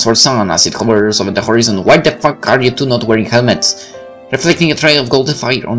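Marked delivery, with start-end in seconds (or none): none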